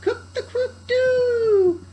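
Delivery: very high, loud, slow crow; terrified thief mimicking the cockerel; demon judge crowing. A narrator's reading. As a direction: shriek it like a demon. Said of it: "a demon judge who called out".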